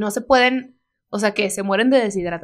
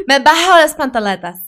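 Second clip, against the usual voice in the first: said through laughter, a bit louder; laughing; 4 semitones higher, 8 dB louder than usual. Real, not acted. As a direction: laugh her way through the correction. Laughs while correcting herself.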